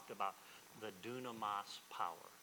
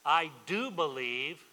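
loud, drawn-out declaration with DO stretched and raised; emphatic plea; 4 semitones higher, 12 dB louder, slower than usual. Retold or imitated. Father answering Jesus in a preacher's retelling, loud emphatic plea. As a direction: proclaim it slowly, leaning hard on "do".

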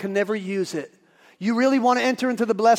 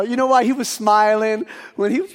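ordinary talk, nothing marked

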